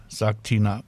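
ordinary delivery

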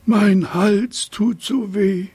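high, slow, whining plaint; high, drawn-out giraffe lament. A narrator's reading. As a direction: moan it like a sore-throated giraffe. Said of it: "Sie jammerte vor sich hin".

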